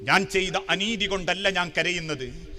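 low, soft retold grief; a touch of sorrow, voice dropped; understated heartbreak. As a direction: answer as a heartbroken man, gently understated. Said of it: "നെഞ്ചു പൊട്ടി തിരിച്ചു പറഞ്ഞു".

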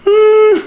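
high, loud imitated whine; dog whine. Owner imitating his dog whining to go out.

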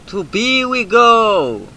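slow, high, loud theatrical charge; mock battle cry. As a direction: proclaim the march like a knight. Rallying cry.

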